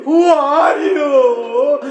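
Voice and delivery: tearful pleading. Acted. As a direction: plead with a stranger in grief. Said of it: "And Bibi Zainab says".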